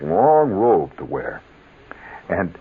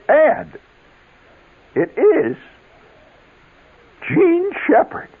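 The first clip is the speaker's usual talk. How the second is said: much higher and louder, with a slow drawn-out name; dramatic teacher reveal; 3 dB louder, slower than usual. Retold or imitated. Teacher revealing the chosen student, high and slow. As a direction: build to the reveal of the winner's name. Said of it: "And she says".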